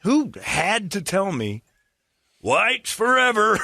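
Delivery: high, slow mock voice; high incredulous mock; mock disbelief. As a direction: draw out the disbelief. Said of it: "I'm like".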